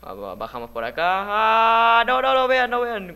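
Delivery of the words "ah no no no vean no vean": high, very loud alarmed yell; panicked scream. Real, not acted. Se asusta al caer jugando. (grito de pánico)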